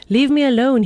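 growled. A narrator's reading. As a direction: snarl it to push her off. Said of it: "he growled".

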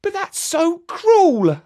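put-on high, slow child's dismay; a little boy upset at cruelty; young boy's voice. A narrator's reading. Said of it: "said Jeremy".

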